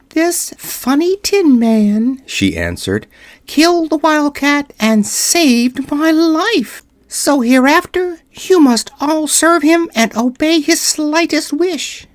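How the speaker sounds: high, tiny voice with royal pride; a little queen drawing herself up; proud mouse queen. Acted. Queen commands her mice.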